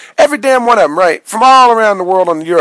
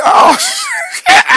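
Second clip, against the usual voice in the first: panicked; 3 dB louder than usual. Retold or imitated. Panicking in the dream.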